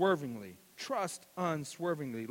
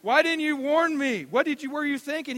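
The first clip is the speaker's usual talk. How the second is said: father after a fall, raised incredulous voice; exasperated dad; 10 semitones higher, 10 dB louder than usual. Retold or imitated. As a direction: scold the kid in mock disbelief.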